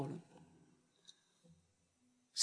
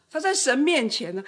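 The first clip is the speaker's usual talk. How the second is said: usual speaking voice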